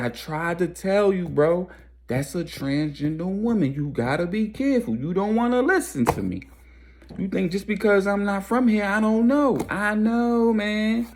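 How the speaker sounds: high-pitched pleading, acted out; exasperated warning. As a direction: plead your case in a high, strained retelling. Retold exasperated warning to friend.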